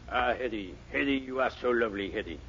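low, soft swooning lover; lovesick star voice. Acted. [name] tries a romantic star voice, low and soft. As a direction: sigh it out to your sweetheart. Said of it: "Let's see now".

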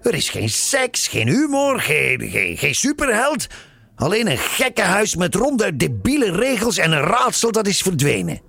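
high-pitched ranting complaint; indignant outcry. A narrator's reading. Frank complaining about the dull story, indignant outcry. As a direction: pile up grievances at a high pitch. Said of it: "riep Frank".

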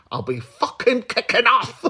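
high-pitched, badly faked Scottish accent. Mock-threatening Ali for not saying hello, bad Scottish accent. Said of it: "That was a really bad Scottish accent".

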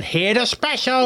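high, triumphant presenting voice; hammed-up, high-pitched crowing; mock gleeful announcement. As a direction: imitate a waiter gleefully unveiling a dish. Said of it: "laughing his head off".

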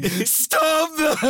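hammed-up high wail, laughing through it; mock crying. Farmer begging to keep sheep from garden, mock crying. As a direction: imitate a grown man near tears. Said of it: "Like a grown man crying".

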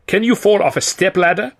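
put-on high-pitched, vague European accent; indeterminate European accent. A narrator's reading. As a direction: ask it with an unplaceable European accent. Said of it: "in an indeterminate European accent".

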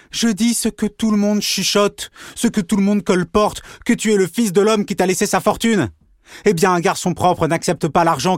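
high angry accusation. Acted. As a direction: throw the rumour in his face. Pierre hurls the accusation.

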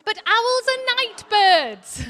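very high, loud, slow mother owl with a laugh; exasperated mother owl. Acted. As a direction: stretch it out high and amused as the mummy owl. Mother owl insists to Plop.

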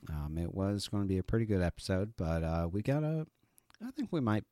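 ordinary delivery

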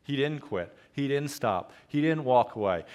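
normal talking voice

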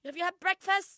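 high, loud, oblivious question; loud mum impression. Imitating mum on the tube, loud high questioning. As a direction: ask it for the whole carriage to hear. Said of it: "she's so loud".

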